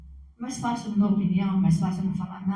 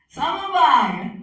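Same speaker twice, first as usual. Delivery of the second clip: called-out guess; 7 semitones higher, 9 dB louder, much slower than usual. Retold or imitated. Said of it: "Aí".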